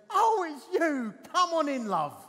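big, loud, high drawn-out greeting; effusive welcome. Retold or imitated. Sue welcomes the vicar at her door, loud effusive greeting. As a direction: imitate a householder hailing a welcome visitor.

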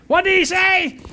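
deaf wife shouting at her husband to repeat it; blurting wife. Acted. Wife voiced in joke.

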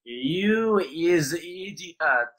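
slow, drawn-out insult in a fake Polish accent. Insulting a player who mocked him, fake accent. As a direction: drag it out in a mock Polish accent. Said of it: "the worst fake accent ever".

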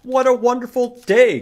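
high, loud, quick happy groundhog; cheerful groundhog voice. Acted. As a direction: chirp it out like a delighted little animal.

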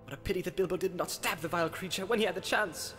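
soft, high and quick, heard at a distance; distant, agitated voice from the past; far-off remembered voice. Acted. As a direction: exclaim it like an echo of memory. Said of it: "quite plainly but far off".

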